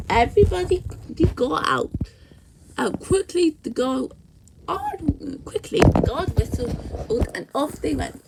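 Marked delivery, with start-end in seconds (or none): none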